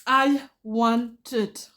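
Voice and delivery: drawn-out want. Acted. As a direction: savour every word of "I want it". Repeating her wish with relish.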